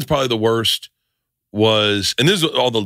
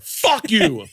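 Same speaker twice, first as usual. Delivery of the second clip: higher-pitched imitation of an outraged man; mock angry outburst; 9 semitones higher than usual. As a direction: blurt it out as the offended guy being led away. Blind man angrily grabbed, retold.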